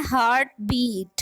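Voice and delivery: Heart बीट with equal weight on each part; stress on both syllables. Shown as a demonstration. Teacher giving a spondee example, both heart and बीट stressed. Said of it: "heart भी actually stress है और बीट भी".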